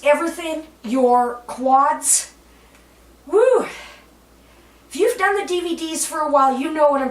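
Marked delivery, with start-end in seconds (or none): 3.3-3.7 high, loud "woo"; effort whoop. Real, not acted. Instructor feeling the hamstring stretch, whooping. Exclaims during a demanding stretch.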